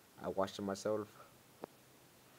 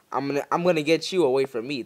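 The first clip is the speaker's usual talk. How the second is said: urgent loud threat; 7 dB louder, faster than usual. Real, not acted. Fending off monster.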